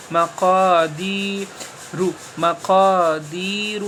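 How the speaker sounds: each word drawn out very slowly, long vowels held; slow fluent reading. Shown as a demonstration. Teacher reading words fluently for students, very slow. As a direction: stretch every word, holding the long vowels. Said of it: "رواں کی طرح ہجے،".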